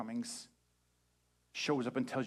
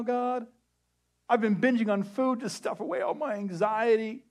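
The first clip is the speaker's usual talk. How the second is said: much higher, louder pleading whine; whiny excuses; 10 semitones higher, 8 dB louder than usual. Retold or imitated. Believer begging God not to pick them, whining. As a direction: list excuses like a panicking kid. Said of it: "tell him all the reasons".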